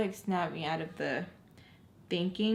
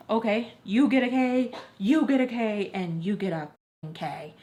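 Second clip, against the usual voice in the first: mock giveaway; 2 semitones higher, 6 dB louder than usual. Okaying everybody in turn, mock giveaway. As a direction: hand them out like a show host. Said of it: "I was just okaying everybody that day".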